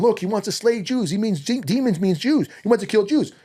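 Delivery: a hint of a hammed-up accuser; mocking his critics. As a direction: parody the critics crying wolf to Elon. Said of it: "they showed Elon".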